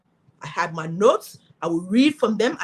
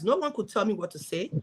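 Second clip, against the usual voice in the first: ordinary talk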